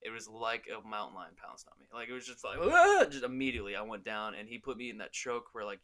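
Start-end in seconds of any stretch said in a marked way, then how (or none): 2.6-3.0 high loud pouncing yell; hammed-up attack shriek; mock roar. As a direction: roar like a lion leaping in. Opponent pounces like mountain lion.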